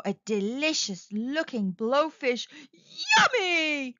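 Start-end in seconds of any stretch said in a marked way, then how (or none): 3.2-4.0 high, delighted storybook exclamation; eager cry. Acted. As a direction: cry out at the sight of food. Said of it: "she cried".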